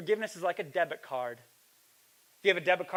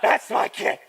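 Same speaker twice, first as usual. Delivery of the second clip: loud, raised mock yell; imitated angry man; 6 semitones higher, 10 dB louder, slower than usual. Preacher haunted by the furious father, hammed-up angry yell. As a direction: bellow the callback for laughs. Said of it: "he's just angry".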